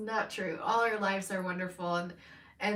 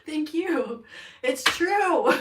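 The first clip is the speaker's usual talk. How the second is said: high, loud, laugh-choked agreement; 8 semitones higher, 9 dB louder, much slower than usual. Real, not acted. Reader agrees it's true though she shouldn't laugh, laughing. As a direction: cry it out through helpless laughter. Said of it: "I shouldn't laugh".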